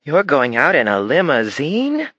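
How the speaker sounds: put-on high, slow, awestruck child's question; amazed girl. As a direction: marvel at it like a dazzled little girl. Daughter amazed by the limousine.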